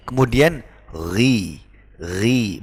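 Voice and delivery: ri said slowly with clear i, not wi; correct ri. Shown as a demonstration. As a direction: demonstrate gently. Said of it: "bukan wi". (bacaan benar ri)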